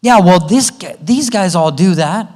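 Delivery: put-on loud, high, slow whining kid; whiny kid. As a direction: play the complaining kid. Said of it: "my kids are like".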